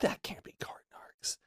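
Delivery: breathless whisper of disbelief; awed whisper. Retold or imitated. Whispering in disbelief on seeing the cart guy. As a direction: hush it like you can't believe your eyes.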